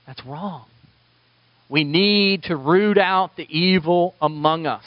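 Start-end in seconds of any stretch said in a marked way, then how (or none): 0.1-0.7 much softer, almost under the breath; hushed verdict. Real, not acted. Pastor repeating his verdict on shielded predators, very softly. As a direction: let the second verdict sink in, barely voiced.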